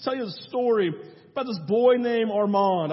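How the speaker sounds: ordinary talk, nothing marked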